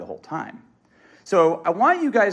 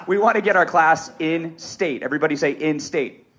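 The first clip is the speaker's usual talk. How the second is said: normal talking voice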